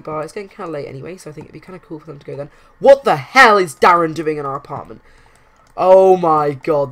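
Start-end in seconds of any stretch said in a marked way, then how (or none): 2.8-6.9 loud outraged cry at the screen; shocked outburst. Real, not acted. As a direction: yell it out, truly appalled. Darren turns up in apartment.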